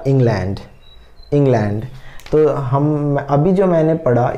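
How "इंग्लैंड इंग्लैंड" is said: England with a lightly said ING and a full LAND; light ING, stressed LAND. Shown as a demonstration. Teacher repeating England for students, second syllable stressed. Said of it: "इंग को हमने lightly बोला".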